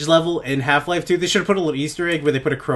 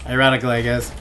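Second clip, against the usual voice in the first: ordinary delivery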